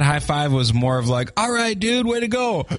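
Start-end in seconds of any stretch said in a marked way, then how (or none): 1.4-2.7 bro voice. Retold or imitated. Imitating a cheering buddy.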